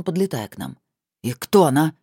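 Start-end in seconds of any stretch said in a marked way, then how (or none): 1.2-1.9 louder, angry question. Acted. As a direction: demand it, annoyed. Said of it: "Рассерженно указал на меня".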